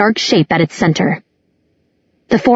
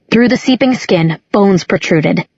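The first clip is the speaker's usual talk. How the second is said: usual speaking voice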